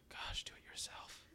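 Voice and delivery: whispered grumble; very soft, voiceless husband's grumble; under the breath. Acted. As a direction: whisper a resentful aside. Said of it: "doing it underneath your breath".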